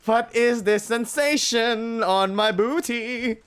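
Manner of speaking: high, loud, slow mock wolf voice; hammed-up smug wolf; smug mock alarm. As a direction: tease her with fake shock. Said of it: "he grins smugly like".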